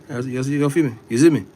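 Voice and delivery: loud Jamaican patois tag; Jamaican accent. Pretend. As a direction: punch it out Jamaican style. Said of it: "I was speaking Jamaican".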